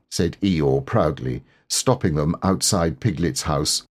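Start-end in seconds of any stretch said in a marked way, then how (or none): none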